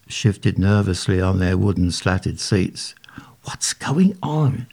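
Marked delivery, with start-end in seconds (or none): 3.4-4.6 slow urgent whisper, higher; hissed. A narrator's reading. Harold hisses at the narrator, scared, slow whisper. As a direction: hiss it under your breath. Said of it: "Harold hissed".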